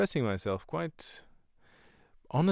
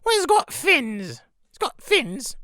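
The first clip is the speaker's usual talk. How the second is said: very high, loud put-on woman's voice; imitated sketch wife; 9 dB louder, slower than usual. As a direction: squawk it slowly like a comedy housewife. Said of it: "and she goes".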